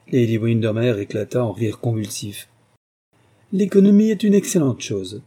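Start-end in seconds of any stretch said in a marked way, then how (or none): 3.5-5.2 high, louder, self-satisfied remark; complacent. A narrator's reading. Duchess approving talk of her thrift, complacently. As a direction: say it pleased with your own virtue. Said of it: "remarqua la duchesse avec complaisance".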